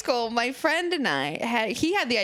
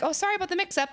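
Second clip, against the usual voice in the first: cashier; 9 semitones higher, much faster than usual. Retold or imitated. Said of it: "they're like".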